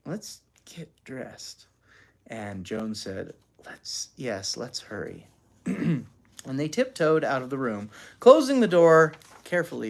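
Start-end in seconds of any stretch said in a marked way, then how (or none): 0.0-1.7 very soft, slow whisper. A narrator's reading. Daughter to her amazed sister, whispering. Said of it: "Anne whispered". 3.7-5.2 low, very soft reply; hushed. A narrator's reading. Sister agreeing to slip away, hushed.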